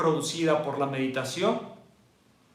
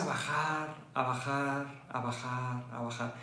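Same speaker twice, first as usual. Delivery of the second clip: softer and lower each time; fading down; 6 semitones lower, 13 dB quieter than usual. Shown as a demonstration. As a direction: sink the voice gently. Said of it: "muestra cómo baja". (bajando la voz hasta apagarse)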